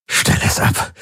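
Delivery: breathless whispered begging; barely voiced, desperate plea. A narrator's reading. Tormented man whispers at the singing in his head, begging. Tortured man begs noise stop.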